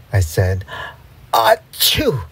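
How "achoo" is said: high-pitched acted "achoo" sneeze. A narrator's reading. Narrator acts out the sneeze.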